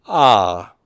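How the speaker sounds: "Ah" gliding steadily downward; falling tone. Shown as a demonstration. Teacher showing the grave accent, "Ah" in falling tone. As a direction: let the pitch slide down through "Ah". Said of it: "would be falling tone".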